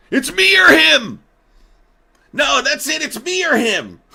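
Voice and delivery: very high, shouted jealous demand; mock ultimatum. Vice president demanding president pick one, mock screaming. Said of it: "They're gonna have a moment like that".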